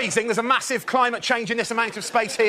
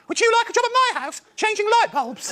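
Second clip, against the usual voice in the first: put-on much higher sweet old lady voice; little old woman; 14 semitones higher than usual. Little old woman asking the tall man to change bulbs, high sweet old lady voice. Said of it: "she asked me this question".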